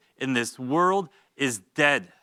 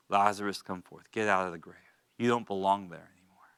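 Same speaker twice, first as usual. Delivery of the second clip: hushed, lower call to the dead; soft, low call; 6 semitones lower, 6 dB quieter than usual. Acted. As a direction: call him gently from the grave. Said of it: "Jesus's call, retold softly".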